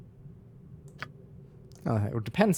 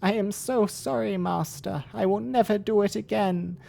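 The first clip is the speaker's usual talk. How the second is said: higher, begging goat voice; 8 semitones higher, 4 dB louder, slower than usual. Acted. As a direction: beg for forgiveness, higher. Goat servant begs, higher.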